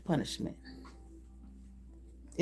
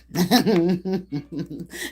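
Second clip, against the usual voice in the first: laugh. Real, not acted. Laughing at the situation.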